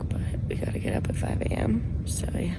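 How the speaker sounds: hushed whisper so others can sleep; breathy whisper, barely voiced. Real, not acted. Whispering in dark tent at night.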